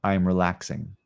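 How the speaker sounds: slow, calm affirmations spaced by long silences; guided meditation. Acted. Yoga teacher leads live class through I am practice, slow and calm. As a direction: sink into each statement slowly.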